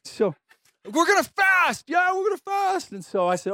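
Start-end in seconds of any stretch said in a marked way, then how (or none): 0.8-2.9 mock rallying shout. Fired-up student rallying friends to fast. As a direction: pump up the group like a zealous student. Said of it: "one of the guys said".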